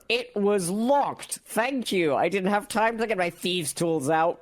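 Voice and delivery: high-pitched mock indignation; hammed-up squawking comeback; indignant retort. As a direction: protest shrilly at being blamed. Acted quarrel, player retorts in character.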